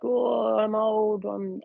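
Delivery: high, drawn-out mock old-person groan; imitating old person. Kids pretending to be old, imitated high old-person voice. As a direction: play at being old in a high pitch. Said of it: "they keep pretending they're old".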